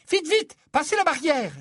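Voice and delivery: put-on very high and loud, hurrying the children through; anxious mother urging haste; flustered hurry. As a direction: rush them along, flustered.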